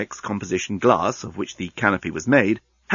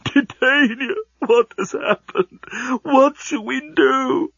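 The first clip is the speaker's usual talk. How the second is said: much higher, slow sobbing plea; 13 semitones higher, slower than usual. Acted. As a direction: plead through sobs. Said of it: "he was sobbing".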